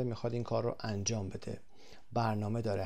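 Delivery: usual speaking voice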